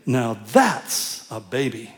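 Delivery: mock admiring pastor to a proud mother; tactful compliment. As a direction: stall for time while praising. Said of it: "You say".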